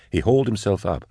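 usual speaking voice

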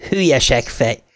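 mocking cackle, then the insult; jeering laugh. A narrator's reading. Ghost boy laughing at Henry, scornful. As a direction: laugh at him and spit out contempt.

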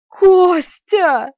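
high, loud wail of his name; acted tearful call, drawn out; sobbing cry. A narrator's reading. Distraught woman calls out to Kostya, sobbing. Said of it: "прорыдал Полина".

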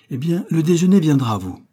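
high, loud exclamation; exclaimed. A narrator's reading. Young man announcing lunch to the busy painter, exclaimed. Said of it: "s'écria-t-il".

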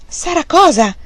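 high, loud, abrupt question; impatient high-pitched demand; brusque. A narrator's reading. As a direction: demand it sharply. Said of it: "chiese Fanny bruscamente".

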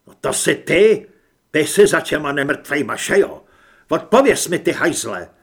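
loud, forceful interrogation; angry accusation. Acted. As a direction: confront him and demand an answer. (rozzlobené, hlasité obvinění)

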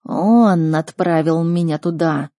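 slow, accusing creature voice; heavy, stretched beast speech; talking beast. Acted. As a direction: draw out each word like a wronged animal. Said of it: "произнесло животное".

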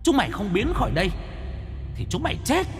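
high, soft, eerie ghost threat; haunting whisper-like menace from a ghost. A narrator's reading. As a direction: female ghost threatening two men. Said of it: "giọng nói đầy ma mị".

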